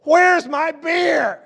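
high, slow, drawn-out shouted demand; mock yell of grandpa. Grandpa on crutches yelling for his beer, hammed-up shout. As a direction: bellow it like a cranky old man wanting his drink. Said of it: "And he's like".

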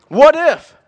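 "What if?" thrown out high and loud; "What if?" punched high and loud with a falling end; excited what if. Acted. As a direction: fire the question at them. Preacher imagining the bolder outcome.

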